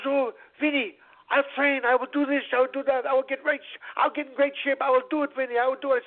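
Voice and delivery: high-pitched, breathless run of promises; acted boyish eagerness, voice held high; eager kid. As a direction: promise everything like a thrilled boy.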